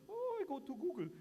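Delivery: a hint of a flippant colleague walking away; dismissive colleague. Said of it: "Or they say".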